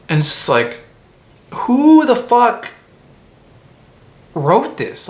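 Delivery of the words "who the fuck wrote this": exasperated. Real, not acted. Exasperated rant about the movie.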